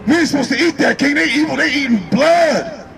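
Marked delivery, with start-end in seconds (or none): 1.2-2.9 slow, hammered preacher's cry; emphatic preaching. Acted. Preacher warning the crowd, slow and emphatic. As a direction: drive it home slowly. Preaching about eating blood.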